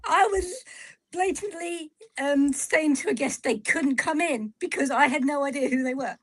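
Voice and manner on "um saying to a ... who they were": ordinary delivery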